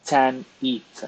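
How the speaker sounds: "Tan Eat" as two separate syllables; sounding out phonetics. Shown as a demonstration. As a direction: read "Tan Eat" piece by piece, long ee. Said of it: "terrible at reading phonetics".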